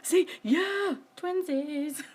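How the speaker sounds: high, loud squeal of delight; excited cheer. Real, not acted. Matching items, excited.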